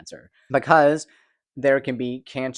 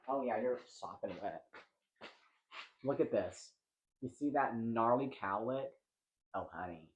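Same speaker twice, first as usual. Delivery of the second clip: much softer, as if to the cat; fussing over pet; 13 dB quieter than usual. Real, not acted. Vlogger cooing over their freshly groomed cat, softly. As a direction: drop the volume and murmur fondly.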